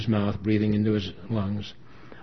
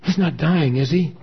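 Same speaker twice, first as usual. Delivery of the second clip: high, louder anxious question; alarmed plea; 7 semitones higher, 7 dB louder than usual. A narrator's reading. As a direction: beg to be told it isn't so. Daughter alarmed about dying father.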